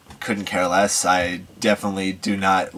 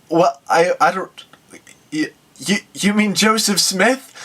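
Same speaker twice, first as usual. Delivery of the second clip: a stammering put-on Australian accent, pitched higher; flustered mock Australian accent; 8 semitones higher than usual. Operator stumped by the prophet question, flustered Australian accent. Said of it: "he's got this Australian accent".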